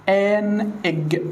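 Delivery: آن إج drawn out slowly; slow example. Shown as a demonstration. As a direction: hold each sound of آن إج like a model answer. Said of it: "يعني مثلًا قلت لسعادتك". (نطق بطيء للمثال)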